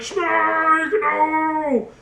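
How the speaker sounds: high, drawn-out wail of a falling villain; mock falling cry.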